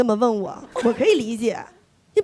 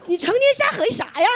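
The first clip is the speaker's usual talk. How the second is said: mock scolding; 10 semitones higher, much faster than usual. Scolding mom, fast and high.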